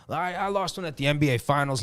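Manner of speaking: ordinary talk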